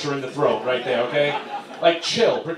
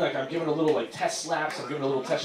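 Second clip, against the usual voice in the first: ordinary talk, nothing marked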